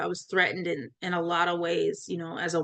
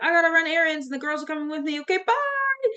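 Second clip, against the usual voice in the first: much higher, airy and offhand, hurrying out; breezy excuse; 8 semitones higher than usual. Retold or imitated. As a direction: toss it off lightly, already out the door. Retelling casual escape excuse.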